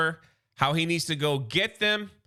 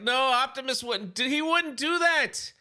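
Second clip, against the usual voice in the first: protesting; 8 semitones higher than usual. Retold or imitated. Captive Cliff Jumper protests.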